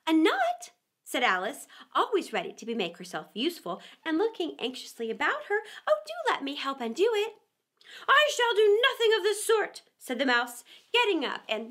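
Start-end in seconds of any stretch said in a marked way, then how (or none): 0.1-0.7 high, puzzled little question; a girl caught off guard; surprised. Acted. Alice misunderstands, surprised. 5.9-7.3 quick, high and eager offer. Acted. Alice eager to help. 8.1-9.8 high, loud, huffy refusal; a mouse deeply offended; indignant mouse. Acted.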